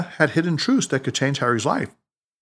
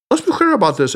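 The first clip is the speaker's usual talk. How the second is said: higher, earnest explaining; intent; 7 semitones higher, 4 dB louder than usual. A narrator's reading. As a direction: set a friend straight with intent focus. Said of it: "explained Tanaka intently".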